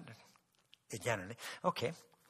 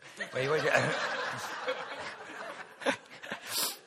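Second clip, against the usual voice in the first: breathy laugh. Real, not acted. Laughs at his own advice.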